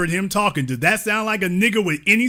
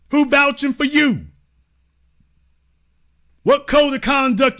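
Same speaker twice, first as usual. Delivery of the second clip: shouted grilling; 5 semitones higher than usual. Acted. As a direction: fire each question at him.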